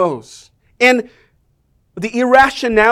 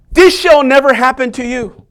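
loud, forceful protest; indignant rebuke; 4 semitones higher, 7 dB louder than usual. Acted. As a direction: rebuke him hard. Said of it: "began to rebuke him".

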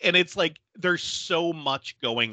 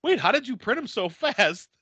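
higher, baffled cop voice; puzzled cop; 8 semitones higher than usual. Retold or imitated. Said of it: "the one cop's like".

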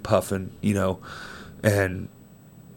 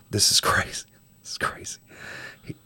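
hushed disbelief; whisper; 2 semitones lower, 7 dB quieter than usual. Real, not acted. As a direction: breathe it out in awe.

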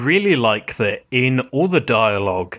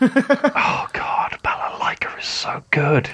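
breathless whisper; 4 semitones higher, slower than usual. Real, not acted. Fangirling over favourite character.

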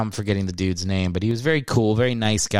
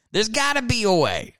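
higher mock-desperate; 10 semitones higher than usual. Shown as a demonstration. Repeats musing on losing listeners.

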